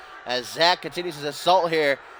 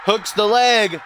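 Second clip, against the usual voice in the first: excited call; 7 semitones higher, slower than usual. Real, not acted. Commentator calls the pin count.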